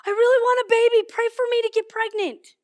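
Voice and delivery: high, loud yell; hollered request. Retold or imitated. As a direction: shout a plea out the window. Lily hollers request from window.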